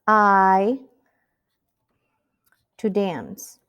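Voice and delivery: stilted, with a long gap after "I"; halting wrong example. Shown as a demonstration. Teacher showing students what not to say, halting. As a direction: separate "I" from "to dance", falling at the end. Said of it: "You do not say".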